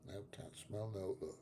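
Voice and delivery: quiet innocent denial; feigned innocence. Retold or imitated. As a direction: deny it with a straight face. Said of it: "I go".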